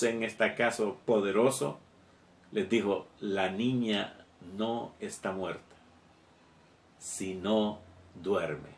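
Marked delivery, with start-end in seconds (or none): 3.2-8.7 más bajo, alargando "sino duerme" muy despacio; suave y pausado. Acted. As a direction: deja caer las palabras con calma, casi en susurro. Jesús habla a la gente que llora. (Jesús consuela a los que lloran, bajo y lento)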